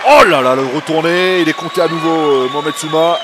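excited. Real, not acted. Fighter knocked down, counted again.

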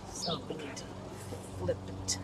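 soft unvoiced whisper while sewing; whispered to herself. Real, not acted. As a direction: murmur it under her breath, working.

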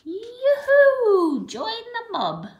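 high, loud whooping call; shouted whoop. A narrator's reading. Animals shouting a welcome to the marabou stork. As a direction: whoop it out across the plain. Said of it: "the other four shouted".

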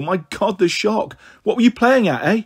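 high-pitched, shocked scolding; cross. A narrator's reading. Mother shaken after the police call, cross. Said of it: "Don't be cross, Mum".

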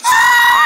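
very high wordless shriek; piercing high squeak; voiceless squeal. Acted. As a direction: squeal as a failed attempt to talk. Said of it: "did your voice come back?".

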